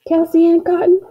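high, loud call across the yard; yell. A narrator's reading. Girl calling her sister in the backyard, angry yell. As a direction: holler the full name high and hard. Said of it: "I yelled".

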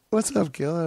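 hammed-up greeting. Repeating Ralphie's greeting.

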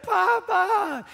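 put-on loud, high, drawn-out child's cry; hammed-up wailing call; mock tantrum wail. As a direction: imitate a little girl bawling for grandpa. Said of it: "She starts throwing a fit".

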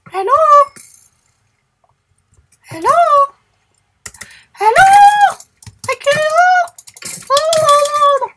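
high, loud call of hello; loud rising hello calls. Real, not acted. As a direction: shout out to someone far away. Calling out to unseen player.

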